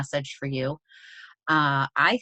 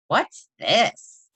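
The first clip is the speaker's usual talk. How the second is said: a lightly voiced curious girl, louder; 5 semitones higher, 6 dB louder than usual. Girl doing a book report finds the mouse's book, lightly voiced curious question. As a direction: ask it like a puzzled schoolgirl.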